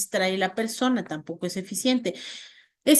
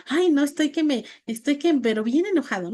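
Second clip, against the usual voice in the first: mismatched angry claim; 6 semitones higher than usual. Shown as a demonstration. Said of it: "porque si yo digo".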